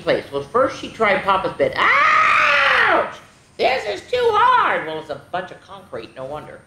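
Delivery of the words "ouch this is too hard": high yelp, slow complaint. Acted. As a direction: yelp then whine too hard. Hurts on concrete bed.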